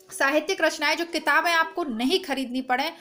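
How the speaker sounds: ordinary talk, nothing marked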